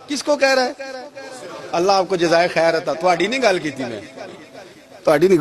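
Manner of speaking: ordinary talk, nothing marked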